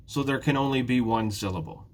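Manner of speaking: ordinary talk, nothing marked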